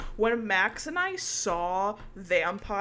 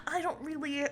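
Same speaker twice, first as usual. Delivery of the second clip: a light shy anime protagonist, pitched up; 6 semitones higher than usual. Pretend. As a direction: play a mildly bashful anime hero. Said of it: "I'd wanna give him an anime protagonist voice".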